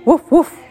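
high, loud woof woof; puppy woof. A narrator's reading. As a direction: bark like an excited puppy. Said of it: "went Willie".

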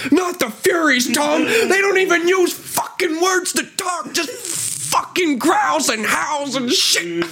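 very high, slow mock shout; hammed-up shouting, dragged out; Johnny Dogs yelling back at Tommy, done as a high, slow mock shout. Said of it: "He's like".

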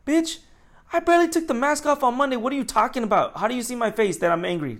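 high, incredulous retort, easing down by the end; indignant comeback. Retold or imitated. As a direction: throw back a mock-outraged reply. His indignant comeback to the accusation.

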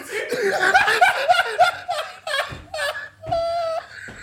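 long high-pitched wheezing laugh; drawn-out, tearful cackle; helpless laughing fit. Real, not acted. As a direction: crack up until it turns into squeals. Cracking up helplessly.

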